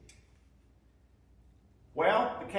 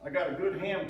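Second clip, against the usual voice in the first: usual speaking voice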